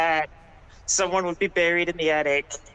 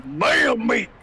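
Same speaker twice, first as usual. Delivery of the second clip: impersonation; 3 semitones higher than usual. Retold or imitated. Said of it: "Jimmy's voice impersonation".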